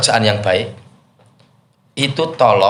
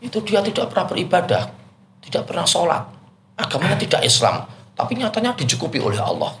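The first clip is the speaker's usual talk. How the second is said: higher, quicker, challenging voice; questioning student; 8 semitones higher, 5 dB quieter, faster than usual. Retold or imitated. Preacher imitating a critical questioner, higher and quicker. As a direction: play the critical student pushing back. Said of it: "ada yang kritis, tanya ke saya".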